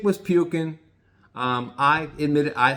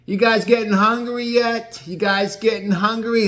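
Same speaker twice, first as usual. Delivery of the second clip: high, loud, jolly call across the boat; Gino calling out; 9 semitones higher, 5 dB louder than usual. Retold or imitated. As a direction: hail everyone from the back of the boat, teasing. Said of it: "he looked back and said".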